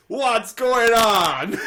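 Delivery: high, loud, very slow mocking caller voice; hammed-up imitation of a radio caller, very slow; caller's put-on voice. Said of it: "this is the voice that she did on the phone".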